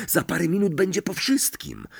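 high, anxious coaxing; insistent urging. A narrator's reading. Neville urges Ron to get it over with, insistent. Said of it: "nalegał Neville".

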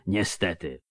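low-voiced, soft mutter. A narrator's reading. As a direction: mutter it under your breath. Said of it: "mruknął Landsknecht". (mrukliwie, cicho pod nosem)

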